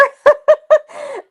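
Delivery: high burst of laughter; big laugh. Real, not acted. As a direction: laugh out loud. Laughs at Keith.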